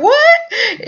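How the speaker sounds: very high squeaked what with a laugh; shocked. Retold or imitated. Player shocked by the new price, high squeak. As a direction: shriek it in disbelief.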